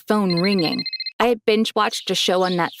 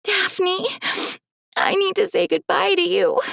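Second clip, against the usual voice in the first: crying; 10 semitones higher than usual. Acted. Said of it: "She couldn't stop crying".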